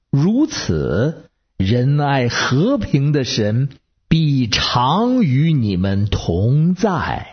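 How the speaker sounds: slow, solemn Bible reading; scripture reading. Acted. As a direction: read each blessing slowly, letting it settle. (缓慢庄重地朗读经文)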